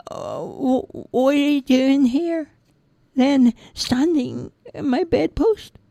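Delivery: put-on high childish voice, hesitant; puzzled little boy; young boy. Roger questions the tiny man, high and unsure.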